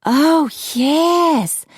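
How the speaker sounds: high, drawn-out swoon; breathed rapture. A narrator's reading. Macy approves Apollo's haircut plan, high rapturous sigh. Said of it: "Macy breathed".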